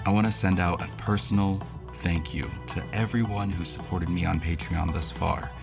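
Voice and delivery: normal talking voice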